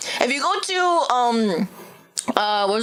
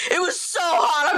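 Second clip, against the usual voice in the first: whiny; 6 semitones higher, slower than usual. Real, not acted. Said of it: "repeats complaint, whining".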